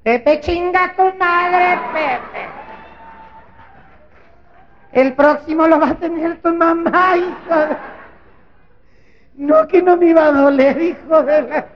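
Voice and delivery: high, loud, drawn-out yelling; screaming woman in labor. Retold or imitated. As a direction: scream at the husband. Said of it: "La vieja nomás decía". (mujer recién parida gritando agudo y fuerte)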